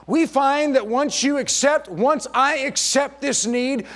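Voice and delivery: ordinary talk, nothing marked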